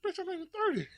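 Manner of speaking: high, laughing protest; laughing mock outrage. Answering cousin who called him old, laughing indignation. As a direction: squeal it out through a laugh.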